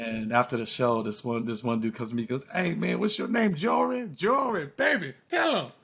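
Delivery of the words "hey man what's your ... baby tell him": high, amazed retelling of an excited fan; high-pitched disbelief, breaking into laughs. Retold or imitated. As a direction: imitate a man amazed by his cure, pitched way up. Acts out amazed audience member.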